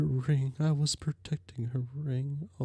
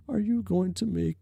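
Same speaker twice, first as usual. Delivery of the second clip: normal talking voice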